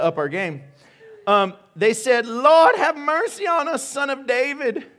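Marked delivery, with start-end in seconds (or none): 2.3-4.9 loud, high-pitched plea; crying out. Acted. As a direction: call out over a crowd for mercy. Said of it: "Cried out".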